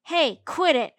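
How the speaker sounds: loud annoyed yell. A narrator's reading. Junie B yelling at May to stop, loud. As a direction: snap at her to stop. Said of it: "I yelled".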